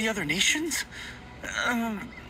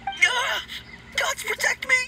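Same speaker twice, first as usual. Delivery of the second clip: much higher, fearful plea to the gods; terrified cry; 13 semitones higher than usual. Acted. Timid undertaker meets strangers, terrified high plea. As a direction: squeal out a frightened prayer.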